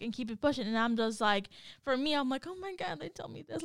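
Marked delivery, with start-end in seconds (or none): 2.4-3.6 high, soft dismay; overwhelmed. Retold or imitated. Wife on what she thinks when told things, soft high dismay. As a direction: act out being overwhelmed, high and quiet. Acts out own overwhelmed reaction.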